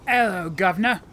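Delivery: loud, hammed-up Cockney greeting. Shown as a demonstration. Player performing on request for a friend, very Cockney. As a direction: call it out in broad Cockney. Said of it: "in a very Cockney voice?".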